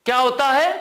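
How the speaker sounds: ordinary delivery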